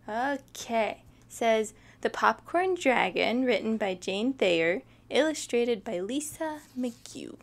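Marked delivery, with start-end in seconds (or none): none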